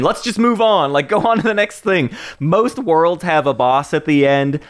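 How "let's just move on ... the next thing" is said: mock hurried dismissal. Voices a level rushing players on.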